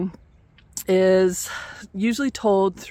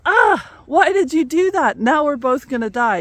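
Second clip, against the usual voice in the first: higher, louder frog crying out in alarm; stung cry; 7 semitones higher, 5 dB louder than usual. Acted. Stung frog cries out at the scorpion, high and loud. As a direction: yelp it like you've just been stung.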